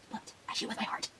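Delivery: breathy whisper of the sacred mantra. Acted. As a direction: whisper it like a secret vow.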